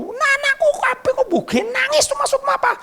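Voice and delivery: high, exasperated Javanese teacher voice; flustered teacher. Retold or imitated. Teacher demanding why her whole class is crying, high and baffled. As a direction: play the bewildered schoolteacher high and shrill.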